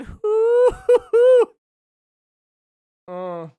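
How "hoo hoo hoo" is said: high, loud "hoo" hoots; high hooting. Shown as a demonstration. Streamer reacting to the justice chats, high wailing hoots. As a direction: hoot high, like a helpless wail. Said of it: "and I'm going".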